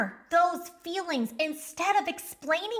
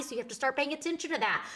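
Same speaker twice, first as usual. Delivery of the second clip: ordinary delivery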